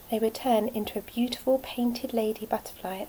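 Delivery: ordinary delivery, nothing marked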